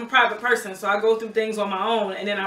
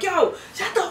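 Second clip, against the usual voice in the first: higher-pitched retold outburst; retold spazzing out; 9 semitones higher than usual. Telling friends how she spazzed on Craig, mock furious. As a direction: snap it out while laughing at the memory. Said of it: "I spazzed on him".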